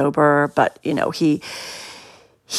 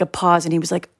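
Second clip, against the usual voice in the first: normal talking voice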